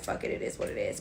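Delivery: breathy unvoiced shrug of a line; shrugging. Staff giving up on the girl, muttered and unvoiced. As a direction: toss it off under your breath. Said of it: "So they just was like".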